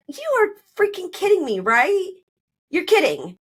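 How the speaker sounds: high, incredulous retold outburst; disbelief. Wife confronting husband over the dip, high disbelief. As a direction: re-enact shock at his excuse.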